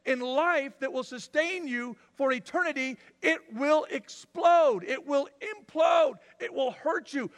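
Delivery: loud, high, slow warning; voicing God's loud warning. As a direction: pound each word like a verdict. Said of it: "and God's going".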